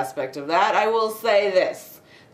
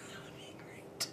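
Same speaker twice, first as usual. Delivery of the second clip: barely audible whisper; whispered aside; 24 dB quieter than usual. Real, not acted. Reviewer wishing for the character's money, whispered aside. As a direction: whisper it as a private wish.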